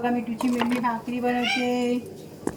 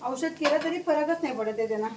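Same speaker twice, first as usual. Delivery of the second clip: usual speaking voice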